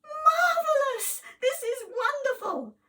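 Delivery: high, loud, stretched-out joyful shout; overjoyed cry. Acted. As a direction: burst with joy. Hero overjoyed at baby's revival.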